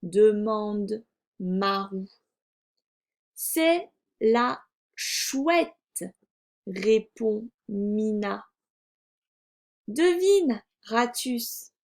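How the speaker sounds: very slow, word-by-word reading; slow reading aloud. A narrator's reading. Teacher models reading for a beginning reader, slow word-by-word. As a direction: read deliberately with gaps between words.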